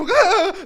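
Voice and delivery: loud, high yelp of fright; short mock scream; startled yelp demo. Shown as a demonstration. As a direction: act out jumping with a sudden cry. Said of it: "like this, right?".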